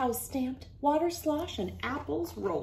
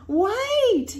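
high loud shout of wait; yell; 13 semitones higher, 8 dB louder than usual. Acted. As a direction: call out to stop the train. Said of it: "yelled Reel-a-Min Sam".

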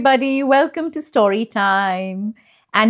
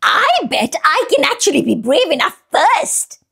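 boastful anteater; 7 semitones higher than usual. Acted.

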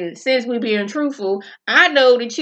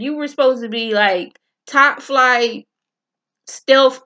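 ordinary delivery

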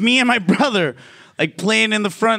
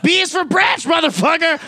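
higher, shouted threat with a laugh at the end; mock dad shout; 7 semitones higher than usual. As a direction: bellow it like a dad grabbing the branch. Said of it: "He's like".

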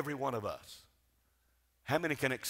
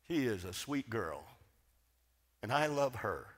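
much softer, lower, intimate storytelling; soft tender remembering; 4 semitones lower, 7 dB quieter than usual. Real, not acted. Preacher to congregation, speaking tenderly of his sister Charlotte. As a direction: hush the room to tell about a dear sister.

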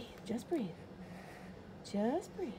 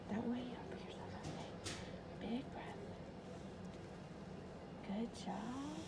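gentle whispered coaching, much softer; 11 dB quieter than usual. Real, not acted. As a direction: keep it to a breath so only she hears. Whispering to calm her scared child.

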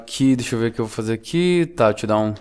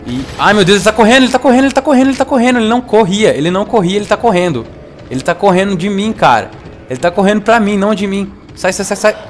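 high, loud panicked yelling; real panic as the monster runs at him; 9 semitones higher, 5 dB louder, faster than usual. Real, not acted. Gamer panicking as the monster charges him, high frantic yells.

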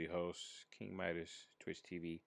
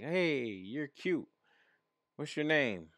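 higher, drawn-out mock come-on; put-on sweet-talking voice, pitched up; mock flirty; 6 semitones higher, slower than usual.

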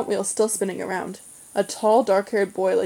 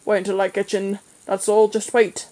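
louder, grumbling annoyance; cross threat; 4 dB louder than usual. A narrator's reading. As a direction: threaten him like a crabby fisherman. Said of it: "he said crossly".

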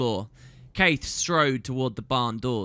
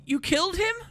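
high, stunned question; can't believe what she sees; horrified disbelief; faster than usual. A narrator's reading. Shock at the murder.

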